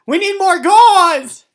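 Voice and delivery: very high, loud, slow hammed-up shout; frantic yell. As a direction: scream for supplies. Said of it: "Mister O yelled".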